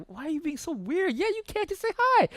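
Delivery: high-pitched imitation of a nagging wife; exasperated wife. Exasperated wife urges nervous husband.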